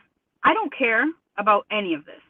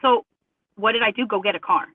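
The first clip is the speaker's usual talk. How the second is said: ordinary talk, nothing marked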